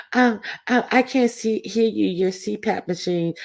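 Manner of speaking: high mocking woman voice; mocking imitation. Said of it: "these innocents coming over here".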